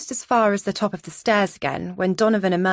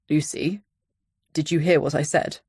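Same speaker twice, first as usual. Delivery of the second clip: Donovan voice; 3 semitones lower than usual. A narrator's reading.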